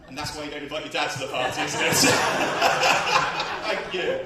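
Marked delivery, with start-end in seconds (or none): none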